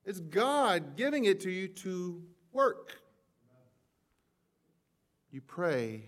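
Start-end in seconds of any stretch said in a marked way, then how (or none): 0.0-3.0 loud, high-pitched fast sermon delivery; rising pulpit fervour; preaching at full force. Acted. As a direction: preach it out to the back row. Preaching on giving.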